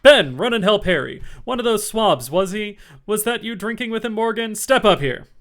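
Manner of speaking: loud, high indignant bellow; angry sailor. A narrator's reading. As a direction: storm at the tavern crowd. Silver roars orders.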